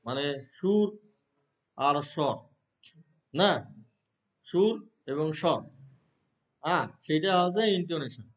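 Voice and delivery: ordinary delivery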